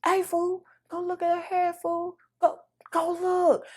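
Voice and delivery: urgently whisper-call your cousins over; getting cousins to look at the haircut, hushed. Retold or imitated. Retold hushed calling.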